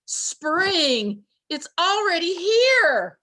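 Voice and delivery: high, loud, slow joyful cry; excited shout. A narrator's reading. Groundhog announcing spring to the woods, shouting. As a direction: shout the good news with delight. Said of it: "he shouted".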